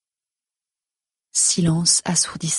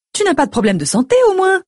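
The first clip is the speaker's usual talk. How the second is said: high, fast questioning, climbing at the end; anxious mother; 12 semitones higher, 5 dB louder, faster than usual. A narrator's reading. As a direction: fret upward as a worried mother. Mother questions her daughter anxiously.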